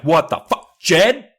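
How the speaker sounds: loud, high mock-furious yell; hammed-up mother shouting the son's name; angry mom yell. Mom discovering the trashed room, yelling at Jed. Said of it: "Mom's just like".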